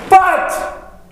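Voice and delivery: "but" popped out high and sudden; emphatic "but". Retold or imitated. Deacons about to contradict their praise, emphatic high "but". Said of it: "whenever somebody says the word "but".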